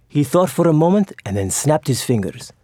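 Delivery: ordinary delivery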